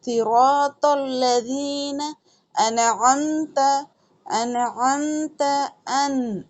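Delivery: slow chanted नअबुदु व इय्याका नस्तईन; Quran recitation. Shown as a demonstration. Teacher reciting for students, slow and melodic. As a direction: recite the verse slowly and melodically.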